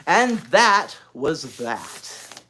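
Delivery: very slow, every word weighted; stern finality. Acted. Narrator stressing the final house rule. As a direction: close it with finality.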